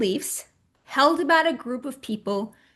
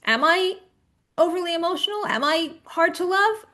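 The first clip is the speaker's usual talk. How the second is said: anxious inner voice; 6 semitones higher than usual. Retold or imitated. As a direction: voice the panicked inner doubt, a touch higher. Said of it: "and we think".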